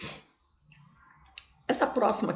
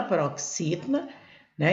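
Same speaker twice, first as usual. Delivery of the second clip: normal talking voice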